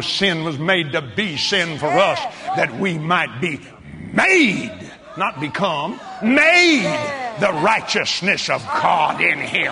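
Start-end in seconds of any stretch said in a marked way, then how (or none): 4.1-4.7 "made" punched high and loud; "made" set apart as a loud cry; stress on made. Real, not acted. Preacher stresses made against become. 6.2-6.8 "made" shouted high; "made" as a ringing, emphatic shout; stress on made. Real, not acted. Repeats made after not become.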